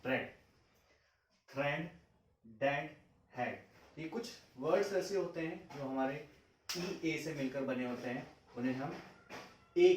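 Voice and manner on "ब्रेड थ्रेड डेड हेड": ब्रेड, थ्रेड, डेड said fast with a short e; quick short e. Shown as a demonstration. Teacher shows the quick short e sound. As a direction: keep the e in हेड quick and short. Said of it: "लेकिन मैं बोल जल्दी रहा हूँ".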